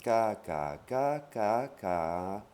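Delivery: ka drawn out five times, each on its own tone; change the pitch contour on each long ka; five Thai tones. Shown as a demonstration. Teacher demonstrating tones to learners, ka in five distinct intonations. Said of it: "les cinq intonations".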